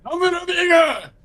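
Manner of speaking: high, loud battle cry; game character shout. Retold or imitated. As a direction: belt it like a hero's war cry. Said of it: "Or whatever he says".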